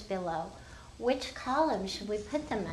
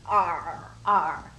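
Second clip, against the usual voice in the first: big loud low pirate arr; kids' biggest loud pirate sound; 4 semitones lower, 6 dB louder than usual. Shown as a demonstration. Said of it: "their biggest loud pirate sound".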